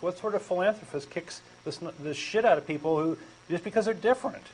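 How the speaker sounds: normal talking voice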